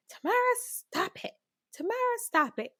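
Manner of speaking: high-pitched imitation of a scolding sister; bossy older sister. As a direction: imitate a big sister ordering you to stop. Older sister orders her to stop.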